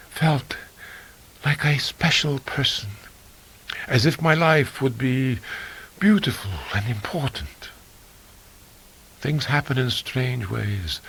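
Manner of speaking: dreamy half-whispered allure; Uli, seductive. Acted.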